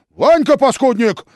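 very high, loud scolding cry; furious yell. A narrator's reading. Philip yelling at his nephew. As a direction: roar at the traitor nephew. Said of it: "заорал и Филипп".

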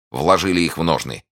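ordinary delivery

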